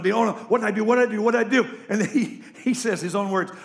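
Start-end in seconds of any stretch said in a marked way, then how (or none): 0.3-1.6 repeated frantic question, louder and higher, half laughing; mock panicked wife. Wife fearing a beating when lifted up, mock panic. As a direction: play the scared wife, hammed-up. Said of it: "she thinks the beating's coming".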